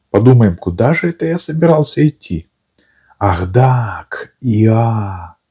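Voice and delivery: Pooh musing. A narrator's reading.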